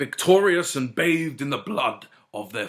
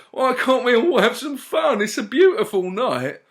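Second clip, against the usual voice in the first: high plaintive plea; pleading hippie fairy; 10 semitones higher, 5 dB louder than usual. A narrator's reading. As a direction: beg them to chill out.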